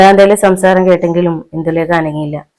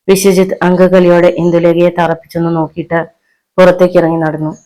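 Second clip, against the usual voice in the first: usual speaking voice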